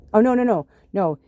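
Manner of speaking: sudden correction. Real, not acted. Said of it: "correcting her own story".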